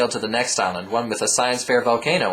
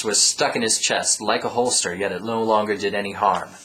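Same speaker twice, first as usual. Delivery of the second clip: usual speaking voice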